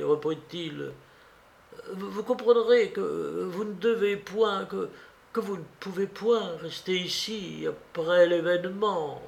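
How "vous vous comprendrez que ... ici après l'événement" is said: lightly voiced bishop, raised pitch, halting; vescovo esitante. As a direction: hesitate and restart as a bishop breaking hard news. Bishop's hesitant speech to Jules.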